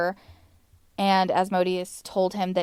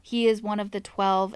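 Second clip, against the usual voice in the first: usual speaking voice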